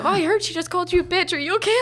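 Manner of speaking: high, quick mock-concerned gossip; gossipy coworker. Coworker at Cactus Club fussing over server, high mock concern. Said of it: "Karens at Cactus Club".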